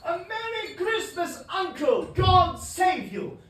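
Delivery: loud, high, beaming greeting; cheerful cry. Acted. Nephew Fred bursting in to greet his uncle, loud and cheerful. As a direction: call out the greeting with delight. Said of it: "cried a cheerful voice".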